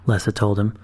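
plain reading voice

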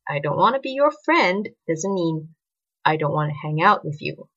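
plain reading voice

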